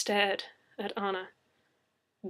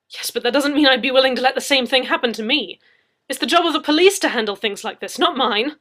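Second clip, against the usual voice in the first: protesting loudly; 4 semitones higher, 13 dB louder, faster than usual. Acted. Leslie voiced loud.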